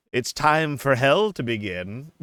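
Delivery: ordinary talk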